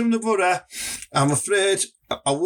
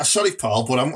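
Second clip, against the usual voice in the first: usual speaking voice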